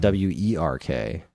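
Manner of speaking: W E R K spelled slowly letter by letter; each letter of W E R K said slow and clear; spelling out. Shown as a demonstration. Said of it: "some game called Clockwork".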